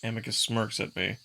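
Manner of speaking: plain reading voice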